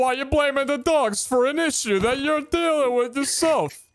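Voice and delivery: very high, scolding New Yorker bit; mock New York accent. Gamer blaming a friend, not the dogs, mock New York accent. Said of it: "as a New Yorker, I feel offended".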